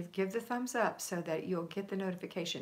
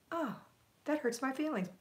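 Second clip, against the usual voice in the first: mock hurt; 7 semitones higher than usual. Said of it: "and you go".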